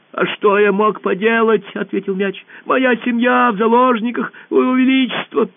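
high squeaky little voice; ball answering the emperor in a squeak. Acted. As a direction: squeak nervously, small and high. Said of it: "пропищал мяч".

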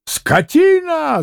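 high, drawn-out cry of insult; high-pitched indignant bellow; outraged yell. Acted. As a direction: yell it in a soaring voice. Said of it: "заорал было пан Врублевский".

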